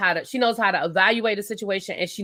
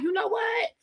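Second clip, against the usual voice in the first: much higher, pressing husband pleading at his wife; heated husband; 8 semitones higher, 3 dB louder than usual. Retold or imitated. Husband arguing with his wife before leaving the house, heated and high.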